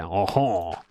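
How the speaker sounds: low mock French "haw haw"; drawn-out French-style ha-ha; French-sounding laugh. Shown as a demonstration. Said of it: "I sounded very French there".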